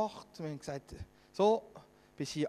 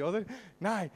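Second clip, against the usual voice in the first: ordinary talk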